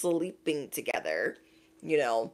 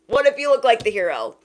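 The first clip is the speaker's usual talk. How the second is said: higher, louder whine; whiny petty voice; 4 semitones higher, 5 dB louder than usual. Retold or imitated. Whiny repeat.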